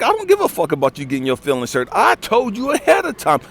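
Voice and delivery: loud, high, fast angry rant; furious boyfriend yelling over thrown-out beans; furious retold rant. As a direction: tear into her, no holding back. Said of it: "And it's like".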